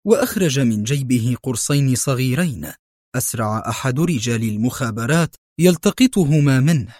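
ordinary delivery, nothing marked

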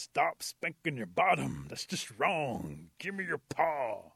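loud, slow, drawn-out order; forceful panther laying down the law; scolding panther. Acted. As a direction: lean hard on each word as a stern telling-off.

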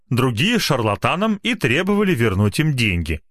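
plain reading voice

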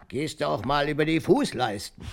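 hoch und laut, nachgeahmte keifende Ehefrau; Oma ruft. Retold or imitated. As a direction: die Stimme der Oma laut nachmachen. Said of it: "rief sie dann". (Opa ahmt die rufende Oma laut und hoch nach)

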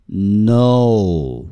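"know" very slow, the O stretched out; drawn-out practice word. Shown as a demonstration. Teacher modelling practice words for learners, drawn-out pronunciation. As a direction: stretch "know" on a long rounded O glide. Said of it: "我们来练习几个单词".

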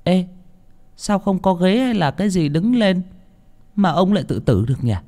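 put-on high-pitched puzzled young boy; child piping up. A narrator's reading. Small boy questioning the villagers about the hanging, high piping voice. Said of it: "nó nói to".

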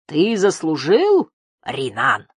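slow, high incredulous hiss; hissing monster. A narrator's reading. Giant toad mocking its presumptuous servant, hissing. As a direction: hiss it slowly with menace. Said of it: "зашипело чудовище".

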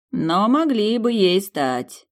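high, drawn-out, needling tone; teasing persistence. A narrator's reading. Demon goading heroine about being a couple, teasing. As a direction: keep goading, stretching the words. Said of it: "не унимался гад".

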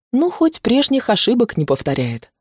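usual speaking voice

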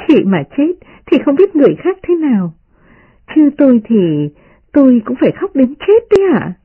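put-on high-pitched playful young lady; voiced girl mock-vowing, well above narration pitch; smiling teasing girl. A narrator's reading. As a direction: tease in a high, lively character voice. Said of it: "Đại Ngọc cười nói".

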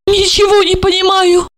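a sharp, high cry of confusion; exclaiming. A narrator's reading. Olga cries out in confusion at Marina, high exclaim. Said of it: "воскликнула она".

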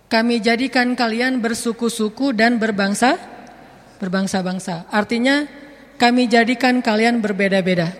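usual speaking voice

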